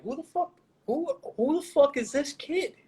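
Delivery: high-pitched incredulous imitation; mock bafflement. Imitating people who saw his wild behavior, mock bafflement. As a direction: mimic stunned onlookers, pitch way up. Voices baffled onlookers' reaction.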